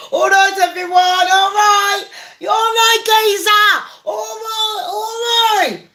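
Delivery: high, shouted mock greeting; mocking Keir Starmer. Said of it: "Keir Starmer's sitting there going".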